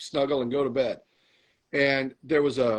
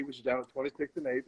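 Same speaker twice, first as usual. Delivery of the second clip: ordinary delivery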